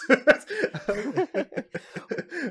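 real high burst of laughter; laughing. Real, not acted. Friend laughs at her own story of the hallway, high burst of laughter. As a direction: laugh breaks out after the story.

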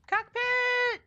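"Cockpit" yelled high and loud; loud high gleeful shout of "Cockpit". Pretend. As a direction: belt out "Cockpit". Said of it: "So keep saying it".

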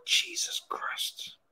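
despairing whisper. Real, not acted. Despair after conceding.

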